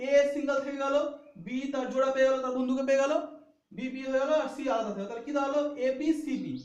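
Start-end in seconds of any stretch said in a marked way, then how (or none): none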